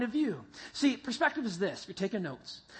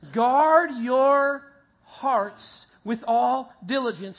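slow, louder, measured group reading; congregation reading; 7 dB louder, much slower than usual. Shown as a demonstration. Pastor leading the congregation in reading the verse, slowly. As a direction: read it out together, slowly. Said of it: "Read it with me".

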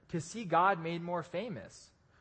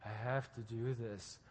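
burdened mutter; 7 semitones lower, 9 dB quieter than usual. Retold or imitated. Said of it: "I'm like".

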